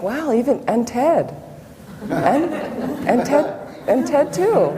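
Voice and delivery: laughing realization. Retold or imitated. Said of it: "And then I was like".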